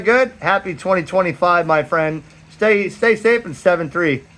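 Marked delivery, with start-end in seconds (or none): none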